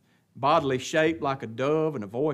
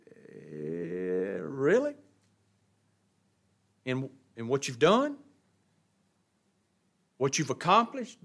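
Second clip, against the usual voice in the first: sceptical questioning; 5 semitones higher than usual. Acted. Challenging those who claim God's pleasure.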